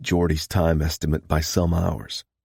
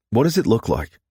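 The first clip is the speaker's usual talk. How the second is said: brusque; 6 semitones higher than usual. A narrator's reading. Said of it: "She thought he seemed a bit brusque".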